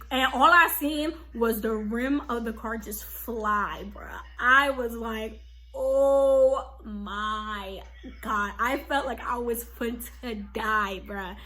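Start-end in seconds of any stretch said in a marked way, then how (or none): 5.7-8.5 very slow, drawn-out horrified exclamation; reenacted crash shock, dragged out; stunned disbelief. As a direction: stretch every word out in mock shock. Retold reaction to the crash.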